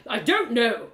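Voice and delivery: loud, high panicked snap; panicked shout. Acted. Theron shouting back at the narrator in panic. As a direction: yell it in alarm.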